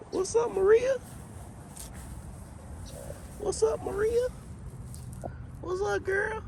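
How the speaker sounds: very high, soft sing-out; playful high-pitched greeting. Pretend. Host greeting Maria among the graves, soft squeaky high voice. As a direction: call out softly in a tiny high voice.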